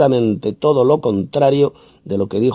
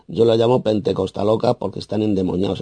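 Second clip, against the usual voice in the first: normal talking voice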